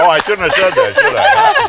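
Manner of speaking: very high, laughing; laughing mock regret. Real, not acted. Host mock-apologizing to Ann for the compliment, laughing. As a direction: fake a sheepish apology through laughter.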